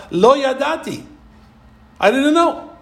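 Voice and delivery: high, innocent-sounding plea; whiny excuse. Retold or imitated. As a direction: play the feigned innocent. Bilam's excuse voiced.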